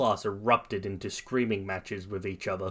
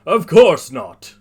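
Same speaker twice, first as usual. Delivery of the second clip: slow, very loud, higher heroic voice; jovial hero; 5 semitones higher, 13 dB louder, slower than usual. A narrator's reading. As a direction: boom it out jovially. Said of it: "All Might laughs and says".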